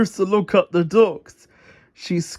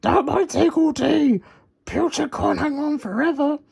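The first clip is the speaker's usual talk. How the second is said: Muck urging; 8 semitones higher than usual. Acted.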